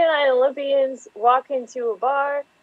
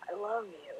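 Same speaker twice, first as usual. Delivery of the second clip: very soft, tender declaration; hushed love confession; 3 semitones lower, 17 dB quieter than usual. Acted. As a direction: confess it barely above a murmur. Star Wars love line quoted.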